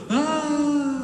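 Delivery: a medium fall, laughing; shorter falling scream. Shown as a demonstration. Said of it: "It's a five hundred-foot cliff".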